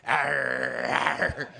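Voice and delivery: imitated crowd snarl; drawn-out mock snarl; angry punk growl. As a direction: growl like a mob of angry punks. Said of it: "every angry young guy".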